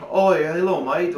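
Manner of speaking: tossing off a cheeky Australian hello; lightly put-on Australian accent; mock Australian accent. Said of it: "oh, that's Australian".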